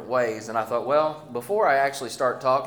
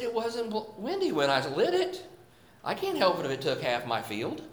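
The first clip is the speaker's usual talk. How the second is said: sudden higher, pleading mock protest; mock defensive excuse; 6 semitones higher than usual. Pastor imitating his own excuse to his kids about the burned field, mock-defensive and pitched up. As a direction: squeak out a half-joking excuse.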